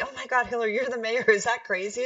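high-pitched excited exclamation; high, incredulous quoted gush; amazed sister. Retold or imitated. Voices sister amazed at her job.

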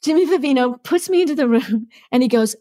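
ordinary delivery

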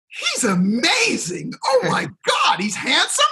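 very high, swooning imitation of a fan; mock adoring gush. Speaker voices the audience admiring him, in a high mock gush. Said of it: "with a big smile on my face".

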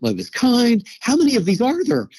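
high, rising mock exasperation; overwhelmed by the growing list; high-pitched, laughing exasperation; exasperated disbelief. Exasperated listing of virtues.

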